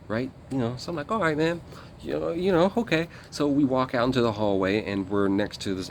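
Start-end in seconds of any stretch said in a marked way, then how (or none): 1.1-3.1 high, sing-song grudging okay; reluctant okay. Retold or imitated. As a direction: go along with it, eyebrows raised in your voice. Reluctantly agreeing to step out.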